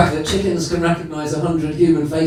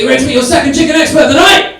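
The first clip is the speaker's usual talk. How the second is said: louder, higher crowd-rousing call; full-on MC hype; 10 semitones higher, 9 dB louder than usual. Real, not acted. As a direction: announce it properly, at full showman volume. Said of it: "So let's do it properly".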